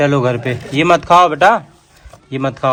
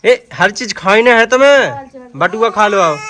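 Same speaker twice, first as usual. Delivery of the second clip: put-on slow, raised scolding at a child; higher and louder, a mother telling off her kid; 7 semitones higher, 7 dB louder, slower than usual. Mother scolding child eating wallet. (बच्चे को ऊँची आवाज़ में डाँटना)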